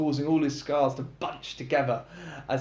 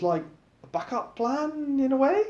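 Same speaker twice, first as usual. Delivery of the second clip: confused; 11 semitones higher than usual. A narrator's reading. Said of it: "confused by herself".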